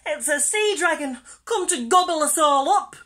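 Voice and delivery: high, loud, panicked warning; shrieking alarm. A narrator's reading. Fish warning friends of a dragon, loud high shriek. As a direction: cry out in storybook terror. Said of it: "shrieked Percy".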